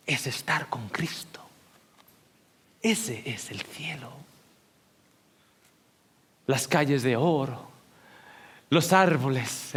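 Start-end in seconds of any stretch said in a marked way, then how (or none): 0.1-4.1 slow unvoiced whisper. Real, not acted. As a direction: hush it like a sacred secret. Sermon climax, hushed. (susurro lento y reverente)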